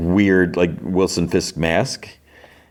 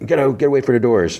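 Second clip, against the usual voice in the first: hurried, higher-pitched imitation of yelling; retold yell, quick and raised in pitch; mock yelling; 7 semitones higher, faster than usual. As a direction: rattle off a mild mock yell. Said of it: "They're yelling at people".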